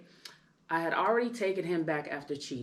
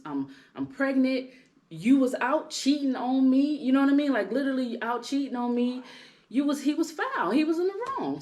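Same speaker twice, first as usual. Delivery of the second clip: a pregnant wife venting about her cheating husband, heated and loud; heated indignation; 7 semitones higher, 5 dB louder than usual. Real, not acted. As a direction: voice climbing higher and louder in outrage.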